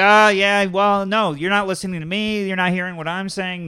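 high, insistent back-talk, loud at the start; imitated defensive arguing. Imitating the line cutter answering the yellers, defensive. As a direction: argue back, talking over the objections.

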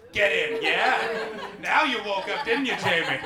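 loud, punchy crowd-rousing tease; loud rallying. Real, not acted. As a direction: egg on the crowd with full projection. Host rouses cheering Scots against English.

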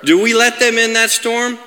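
full-force preaching. Real, not acted. Preacher assures congregation God has them, forceful preaching. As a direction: proclaim it phrase by phrase with conviction.

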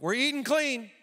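wife's resolution, mimicked high and rising; Julie. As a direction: pitch it up, mimicking a spouse's announcement. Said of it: "Monday morning, she's like".

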